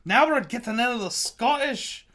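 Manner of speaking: put-on terrible Scottish accent. Streamer announcing the barrel strength whiskey, terrible Scottish accent. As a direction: lean into a bad Scottish accent. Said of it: "My terrible Scottish accent".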